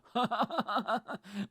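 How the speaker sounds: high burst of laughter; helpless high laugh. Real, not acted. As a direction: crack up at your own joke. Cracks up at his own remark.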